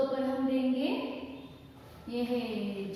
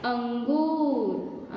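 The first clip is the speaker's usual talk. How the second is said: slow clear naming; much slower than usual. Shown as a demonstration. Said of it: "ये क्या है?".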